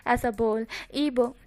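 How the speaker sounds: usual speaking voice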